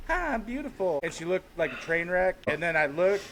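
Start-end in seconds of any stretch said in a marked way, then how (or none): 0.1-1.0 pitched-up mocking sweet ad voice; mock celebrity ad. Mocking Nicole Richie's commercial line in a high sweet voice. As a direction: lampoon a glossy commercial greeting, lifted high. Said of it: "and she's like".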